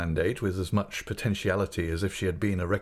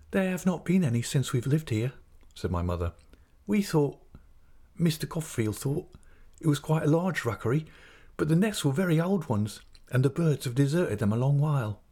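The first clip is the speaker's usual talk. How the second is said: higher-pitched, hesitant character voice; the mother's voice, pitched up; timid young mother figure; 6 semitones higher than usual. Acted. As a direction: answer in a higher voice, halting as you explain.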